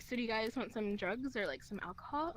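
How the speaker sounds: low-pitched imitation of a stranger; homeless man. Homeless man offers friends drugs, low imitated voice.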